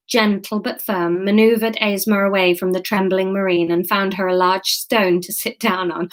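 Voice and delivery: usual speaking voice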